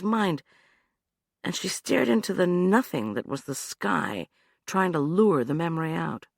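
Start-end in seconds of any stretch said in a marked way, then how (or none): none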